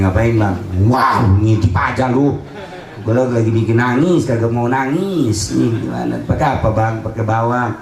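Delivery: low-pitched mock domestic chat; lightly acted couple's exchange. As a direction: play a husband and wife skit, low. Acts husband and peeping wife. (obrolan suami-istri pura-pura, nada rendah)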